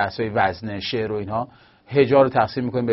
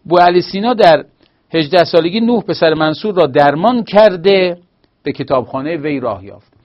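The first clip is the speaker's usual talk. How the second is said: louder formal reading of the example; reading the corrected sentence; 4 semitones higher, 6 dB louder than usual. Shown as a demonstration. Teacher reading the corrected example sentence aloud, louder. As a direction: read it out firmly as the model answer.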